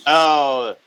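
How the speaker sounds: light mock French accent. Unimpressed French journalist at a show, light French accent. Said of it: "my little French accent".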